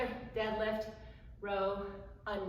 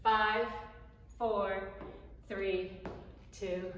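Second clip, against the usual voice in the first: counting workout reps; much slower than usual. Shown as a demonstration. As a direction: coach counting down. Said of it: "I'm counting it down for you".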